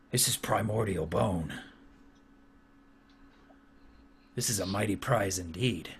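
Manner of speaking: very soft, low, grave warlord; hushed, deep chieftain granting praise; awed orc chieftain. Acted. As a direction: pronounce it solemnly with long pauses. Chieftain admires the prize.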